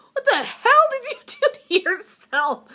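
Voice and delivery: husband shocked at her smeared eye makeup; husband's reaction. Retold or imitated. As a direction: imitate him gaping at the mess. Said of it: "husband comes walking in the bathroom and goes".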